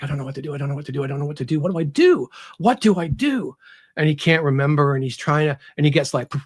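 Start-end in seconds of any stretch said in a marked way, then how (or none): 0.0-3.6 retold crying panic. Author voices Aramis over her limp body, panic building. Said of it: "he's crying".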